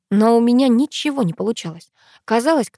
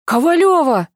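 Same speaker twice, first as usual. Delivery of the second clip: high, loud startled call; alarmed shout of a friend's surname; gasped exclamation; 7 semitones higher, 5 dB louder than usual. A narrator's reading. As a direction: cry the surname out in shock. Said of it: "охнул сверху Пашка".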